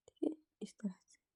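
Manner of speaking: soft whispered aside. Real, not acted. Tailor whispering to herself while joining the sleeves. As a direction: breathe it out as a whisper.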